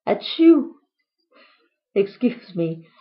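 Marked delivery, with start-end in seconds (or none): 0.1-0.7 loud, high hammed-up sneeze; fake sneeze. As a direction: burst out a big theatrical sneeze. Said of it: "I made the hole by sneezing once".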